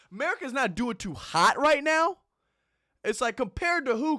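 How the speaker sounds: loud, high disbelieving shout; incredulous outrage. Real, not acted. Ranting at the lady's complaint, loud and incredulous. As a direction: yell it back in disbelief.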